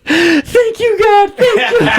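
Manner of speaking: very high, crying scream of thanks; mock screaming thanks. Retelling sister's meme video, hammed-up crying scream. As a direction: wail it out like the man in the meme. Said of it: "he's screaming at the end".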